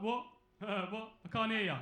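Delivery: loud, mocking "what?" voice; lightly hammed-up mocking of deafness; mocking deaf joke. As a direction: jeer like a bloke pretending not to hear. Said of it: "they always make the same joke".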